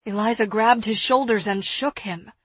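usual speaking voice

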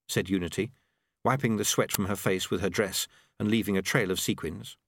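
plain reading voice

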